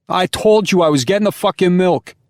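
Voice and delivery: high, loud New York Italian yelling at his wife; New York Italian shouting. Acted. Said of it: "shouting too".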